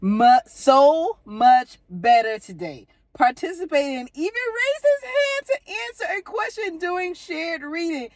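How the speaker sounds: rising to a high, slow shout of joy; proud mother reading the teacher's note, overjoyed; excited reading. Real, not acted. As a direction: read the note out, building to a thrilled cry. Mother reads teacher's glowing note.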